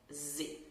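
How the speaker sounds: Z as a soft voiced buzz; z sound. Shown as a demonstration. Teacher repeating the z sound for students. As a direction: hold the Z as a z sound. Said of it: "vai fazer um som de Z".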